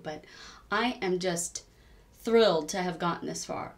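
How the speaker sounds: ordinary talk, nothing marked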